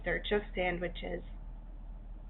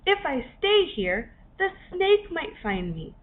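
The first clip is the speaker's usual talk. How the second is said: higher, louder anxious rush of fears; worried crab; 8 semitones higher, 4 dB louder than usual. A narrator's reading. Frightened Henry listing every danger around him, anxious. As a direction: fret faster and higher as dangers pile up.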